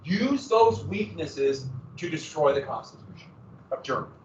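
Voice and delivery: ordinary talk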